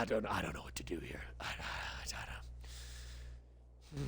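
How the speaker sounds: defeated, breathless whisper; a hushed, fast, hopeless whisper. Real, not acted. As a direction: whisper it, barely there, out of ideas. Despair after losing the crossbow.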